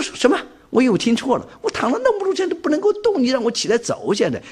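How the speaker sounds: high, incredulous what, you want me to walk; can't believe what he just heard; disbelief. Retold or imitated. Disbelief at being told to walk.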